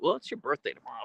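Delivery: normal talking voice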